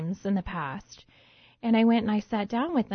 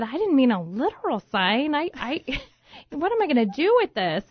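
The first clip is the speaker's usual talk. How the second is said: laughing disbelief; 6 semitones higher, 4 dB louder than usual. Retold or imitated. Laughing disbelief at literal sign.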